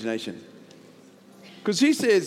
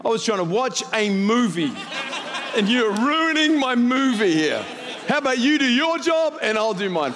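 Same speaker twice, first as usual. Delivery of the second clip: high, loud comic outrage; mock-indignant complaint at the interrupting pilot; hammed-up annoyance, stretching the words; exasperated rant; 7 semitones higher, 4 dB louder than usual.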